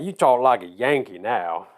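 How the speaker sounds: slow mock Georgia drawl; stretched-out Southern Georgia accent. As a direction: imitate Georgia folks teasing, drawn out. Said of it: "going back down to Georgia".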